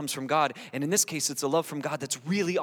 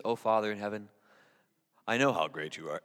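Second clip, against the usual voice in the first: lightly mocked pious praying, lower; put-on prayer voice; 5 semitones lower, 3 dB quieter than usual. Preacher imitates showy praying to God, put-on voice. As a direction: drop into a lofty, insincere prayer voice. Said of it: "when you do that with your voice".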